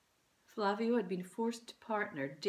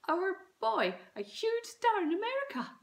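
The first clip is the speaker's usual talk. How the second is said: dreamy excited mum; 13 semitones higher, 5 dB louder than usual. A narrator's reading.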